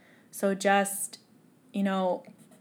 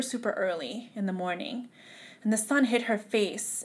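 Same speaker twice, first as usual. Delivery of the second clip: ordinary delivery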